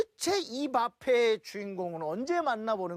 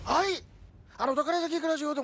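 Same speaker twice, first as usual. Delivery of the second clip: higher, fast fake Japanese gibberish; mock Japanese; 4 semitones higher than usual. Retelling the angry Japanese intruder in mock Japanese. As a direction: rattle off made-up Japanese like an angry samurai. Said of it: "일본어로".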